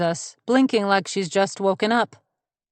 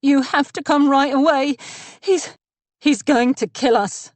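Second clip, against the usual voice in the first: higher, louder, breathless panic on the phone; panicked; 7 semitones higher, 4 dB louder than usual. Acted. Mina fakes panic to the 911 operator, gasping and frightened. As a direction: fake a terrified emergency call. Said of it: "She sounds genuinely panicked".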